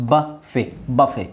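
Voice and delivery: "ಬಫೆ" split as "buh-fay", then whole; silent T. Shown as a demonstration. Teacher correcting buffet for students, silent T. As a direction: end "ಬಫೆ" on the vowel, no T. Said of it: "ಬಫೆಟ್ ಅಲ್ಲ".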